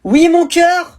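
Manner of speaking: voix de mère très aiguë et forte; répond de loin, tendre. Acted. (mère qui répond de l'autre pièce)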